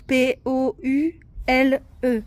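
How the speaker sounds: letters spelled out slowly one by one; spelling aloud. Shown as a demonstration. Mother spelling poule for her daughter, slow and clear. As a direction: dictate each letter for a child. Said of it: "là, c'est poule".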